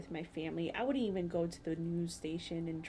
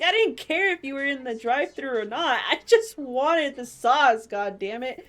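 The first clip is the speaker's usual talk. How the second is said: loud, higher, exasperated rant; louder angry vent, slowing on the sauce line; frustrated venting; 5 semitones higher, 11 dB louder than usual. Retold or imitated. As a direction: vent it all out, much louder. Retold venting, rising anger.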